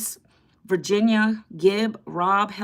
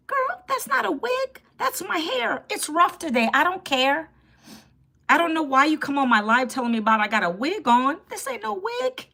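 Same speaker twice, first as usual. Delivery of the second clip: much higher, incredulous protest; indignant; 8 semitones higher than usual. Real, not acted. Streamer indignantly telling a viewer her hair is real, high and incredulous. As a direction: squeal your outrage at the wig claim.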